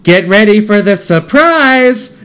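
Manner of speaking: high, drawn-out showy announcement; smiling mannequin head. Retold or imitated. Thrown head speaks to a soldier, imitated smiling announcement. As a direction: imitate a grinning head, stretching each word high. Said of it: "which smiles and says".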